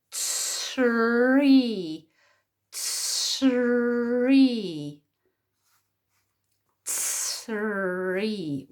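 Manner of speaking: tree stretched very slowly, sound by sound. Shown as a demonstration. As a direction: draw out t, r and ee in tree. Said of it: "what did you hear?".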